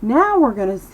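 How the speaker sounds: usual speaking voice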